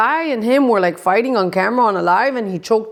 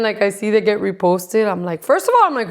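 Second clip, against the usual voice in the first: normal talking voice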